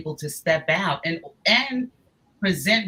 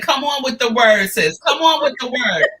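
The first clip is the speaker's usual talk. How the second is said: louder, higher cheering call; excited hype; 5 semitones higher, 8 dB louder than usual. Real, not acted. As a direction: cheer her on loudly. Hyping up viewer comment.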